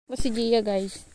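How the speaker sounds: ordinary talk, nothing marked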